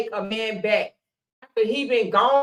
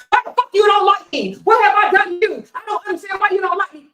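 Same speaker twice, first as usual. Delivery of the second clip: high, loud furious outburst; hammed-up rage; mock angry yell; 9 semitones higher, 7 dB louder, faster than usual. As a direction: explode at them. Imitated man snaps back.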